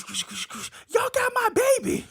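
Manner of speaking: high, loud frantic shout; mom yelling. Retold or imitated. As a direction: scream it like a desperate mother. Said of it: "we was like".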